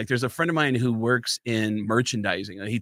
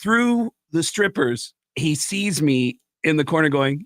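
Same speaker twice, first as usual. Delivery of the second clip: normal talking voice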